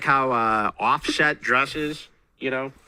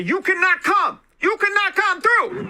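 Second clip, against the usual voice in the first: high-pitched mock guard yell; shrill-pitched, stretched-out order as a mock guard; shouting security guard; 4 dB louder than usual. Host acts out security blocking the fake [name], high mock shout. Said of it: "Here's the security".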